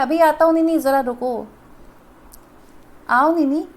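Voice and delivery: calling loudly toward the door. Acted. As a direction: raise your voice to reach the visitor. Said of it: "फिर जोर से बुला रहा है".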